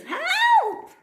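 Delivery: very high panicked cry for help. Acted. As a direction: call out desperately. Mavis crashing, calls for help.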